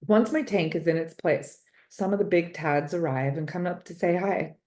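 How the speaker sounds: ordinary delivery, nothing marked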